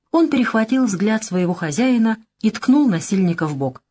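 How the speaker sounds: plain reading voice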